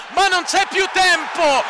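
high, slow emphatic call; excited shout. Real, not acted. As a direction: shout that time is up. Final seconds, repeated call.

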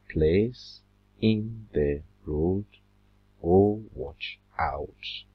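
very slow, syllable by syllable; counting syllables. Shown as a demonstration. Teacher counting syllables to students, very slow. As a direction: spell out the beats of Oh, watch out. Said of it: "How many syllables?".

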